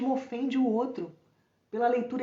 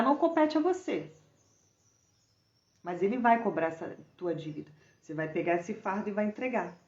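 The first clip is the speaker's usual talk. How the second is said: usual speaking voice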